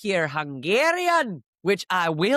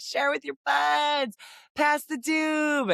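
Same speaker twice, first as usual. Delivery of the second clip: high, slow called-out cheer; mock shout; 9 semitones higher, slower than usual. Calling to parade goers, a slow high shout. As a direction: call it out to the crowd, stretched. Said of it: "But we're always like".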